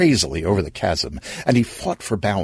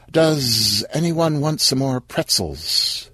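slow, hesitant offer; shyly trying to be heard; timid hostess; 4 semitones higher, slower than usual. Acted. Sheila interrupts timidly.

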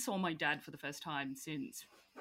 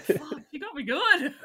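loud, laughing through the words; laughing defeat; 8 semitones higher, 10 dB louder than usual. Retold or imitated. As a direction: concede the point while cracking up. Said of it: "I was like".

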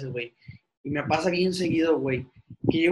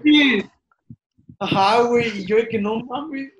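loud and high retelling; excited; 6 semitones higher, 7 dB louder, slower than usual. Retold or imitated. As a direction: build up the embarrassment loudly. Retelling embarrassing greeting.

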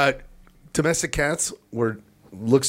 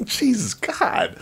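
ordinary talk

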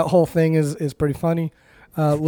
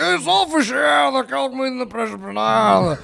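mock shouted warning; 8 semitones higher than usual. Dad character voiced on castle top.

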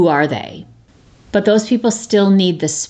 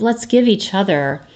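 normal talking voice

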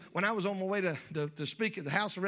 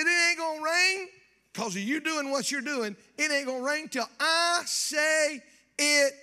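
it ain't gonna rain, higher and louder, slowing at the end; loud, higher vow; 7 semitones higher, 4 dB louder than usual. Acted. Elijah to King Ahab, a high, loud proclaimed vow. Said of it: "Elijah's vow said twice".

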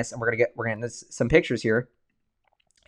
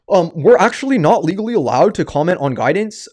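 lightly voiced CFO giving the legal line; legalistic executive. CFO jumps in to decline guidance, mock legalistic voice. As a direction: put on a hint of a legal-disclaimer voice. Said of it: "like, the, the legal".

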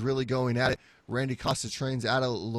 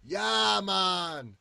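hammed-up Boston accent, pitched higher; a lousy mock Boston accent; jokey fake Boston bro accent; bad Boston accent; 6 semitones higher, much slower than usual. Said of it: "That was a horrible Boston accent".

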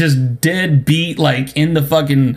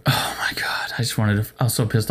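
whispered oh my God, fed up; exasperated whisper; 6 semitones lower, 9 dB quieter than usual. Real, not acted. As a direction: breathe it out unvoiced in disbelief.

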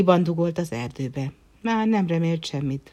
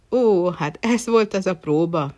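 dawning realization; 4 semitones higher, 6 dB louder than usual. Acted. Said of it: "szólt a herceg".

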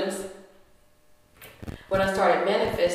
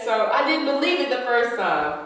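usual speaking voice

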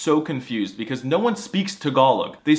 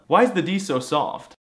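ordinary delivery

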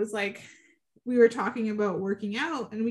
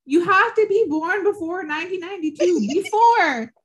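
louder, higher mock lecture; mock stern rule; 7 semitones higher, 6 dB louder than usual. Host telling young guys in her DMs: mock stern. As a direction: lay down the rule to the boys. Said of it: "I'm like".

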